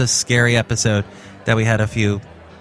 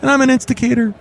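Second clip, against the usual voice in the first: very high, louder squeaky floor man; a squeaky janitor pranking as a senator; Floyd voice; 14 semitones higher, 4 dB louder than usual. Acted. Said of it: "I'm Floyd the Floor Man".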